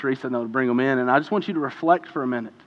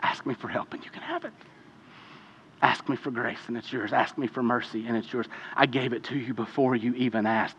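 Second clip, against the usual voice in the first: much softer, calm and tender; gentle reassurance; 3 semitones lower, 7 dB quieter than usual. Acted. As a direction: answer like a patient father soothing a child. Voicing the Father answering gently.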